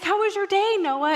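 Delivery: high, loud chirpy mom voice; cheery questioning. Retold or imitated. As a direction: chirp it at your grumpy kid. Mom cheerily quizzing grumpy child.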